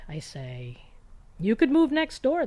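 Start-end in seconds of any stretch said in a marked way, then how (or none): 1.4-2.5 much higher, louder snappy jab on move; sarcastic retort. Retold or imitated. To Joe wanting to be around, a loud sarcastic jab. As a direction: toss it back at him with a bite. Sharp comeback to roommate.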